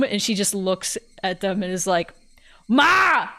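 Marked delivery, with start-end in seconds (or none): 2.7-3.3 high, loud mock yell for mother; cartoon old lady yelling; calling out. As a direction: holler through the house, imitating a cartoon.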